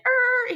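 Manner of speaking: high imitated screech of tires. Police car speeding away from McDonald's, high mimicked screech. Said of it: "he, like, screeches off".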